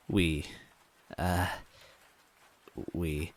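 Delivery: halting, fading hesitation; sighing. Acted. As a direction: struggle to get it out. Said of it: "she sighed".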